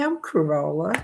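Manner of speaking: ordinary talk, nothing marked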